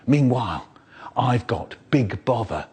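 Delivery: ordinary talk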